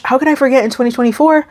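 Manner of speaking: ordinary delivery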